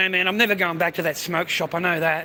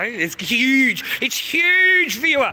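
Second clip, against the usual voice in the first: excited boast; 8 semitones higher, 5 dB louder, much slower than usual. Real, not acted. Bragging to his viewers about his cash, high and loud. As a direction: voice climbing higher and louder on huge.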